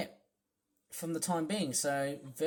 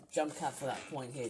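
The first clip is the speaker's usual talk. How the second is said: usual speaking voice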